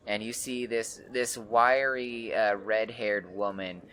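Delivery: usual speaking voice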